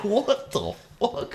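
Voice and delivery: high, drawn-out mock disbelief; slow high-pitched exaggerated shock; incredulous. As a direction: react in stretched-out, squeaky astonishment. Retold disbelief at the gravity bong.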